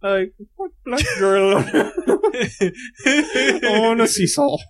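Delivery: a bad, giggly Irish accent that breaks into laughter; Colin Farrell Irish accent. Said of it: "my horrible attempt to impersonate Colin Farrell in Bruges doing his Irish accent".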